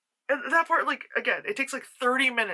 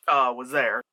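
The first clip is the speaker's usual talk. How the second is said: normal talking voice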